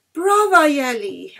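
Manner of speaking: loud, high cheer; exclaiming. Acted. Sick girl cheering the violinist, loud and high. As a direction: burst out in praise. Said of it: "Anna exclaimed".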